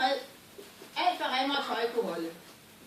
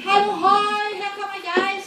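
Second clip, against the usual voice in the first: loud, high hail; a high, loud hallo; calling out; 7 semitones higher, 11 dB louder than usual. Acted. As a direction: call out from far behind. Klods Hans calling out to brothers.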